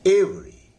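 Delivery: Every said with a loud first ए and a light री; stress on first e. Shown as a demonstration. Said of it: "पहले ए पर जोर लगाइए, री को हल्का". (एवरी में पहले ए पर जोर)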